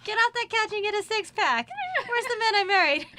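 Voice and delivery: high, loud nagging wife; imitating mom's loud tirade, laughter breaking in; mock scolding yell. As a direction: holler orders at a lazy husband. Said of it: "and screams".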